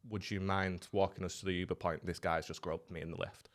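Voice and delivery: soft, low pleading request; hushed plea. Retold or imitated. Girl quietly asking him to walk her to the Uber. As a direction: quietly ask for help. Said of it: "she goes".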